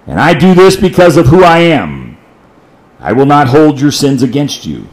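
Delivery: loud fervent preaching of God's words; preaching at full force. Acted. Preacher voicing God to congregation, loud fervent proclamation. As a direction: proclaim it from the pulpit.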